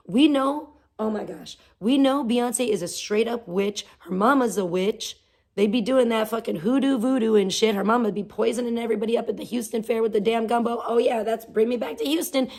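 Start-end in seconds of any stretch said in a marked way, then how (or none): none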